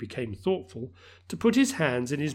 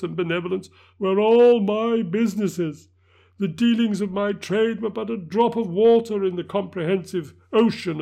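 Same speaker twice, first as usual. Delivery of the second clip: high, loud grieving spirit; unearthly voice; 6 semitones higher, 7 dB louder than usual. Acted. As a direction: proclaim it in torment. Ghost's anguished speech.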